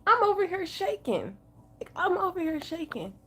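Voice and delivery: real upset, high and small voice; shaken, pitched high and fairly soft; super emotional. Real, not acted. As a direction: speak high, as if near tears. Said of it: "super emotional".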